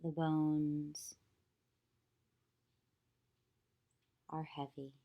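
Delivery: calm, even meditation voice with long pauses; unhurried yoga nidra guidance, leaving long silences; guided meditation. Acted. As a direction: guide them gently into stillness, phrase by phrase.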